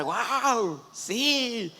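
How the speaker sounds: high, drawn-out cheers; playful whooping exclamations; mock crowd shouting. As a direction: imitate an excited crowd, not at full volume. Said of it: "empezaron a gritar".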